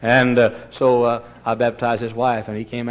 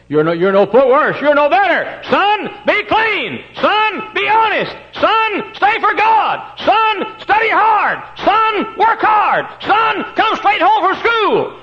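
high, loud preacher's cry with slow words; impassioned preaching; 10 dB louder, slower than usual. Acted. Preacher voicing mother's commands to the congregation, loud and high. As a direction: ring out each of mother's commands.

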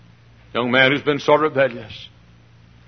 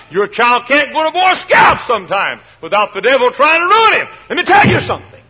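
preaching at full force; 7 semitones higher, 4 dB louder than usual. Acted. Preacher warning the congregation about the devil, building to a shout. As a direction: build each line higher and louder until it's a shout.

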